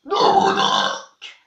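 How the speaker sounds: "Dobrunok" belched out slowly; slow burped greeting. Shown as a demonstration. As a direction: stretch "Dobrunok" over a burp. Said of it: "en rotant".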